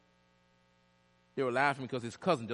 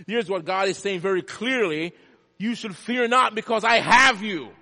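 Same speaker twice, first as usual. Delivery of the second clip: full-force preaching; 7 semitones higher, 10 dB louder than usual. Real, not acted. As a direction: thunder God's promise out to the congregation. Preaches God's promise at full force.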